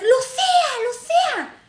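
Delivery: very high, loud holler; big mock yells. Stranger yelling at woman at club door, big high yell. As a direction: belt the name out high at someone far away. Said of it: "Unos gritotes, chamacos, unos gritotes".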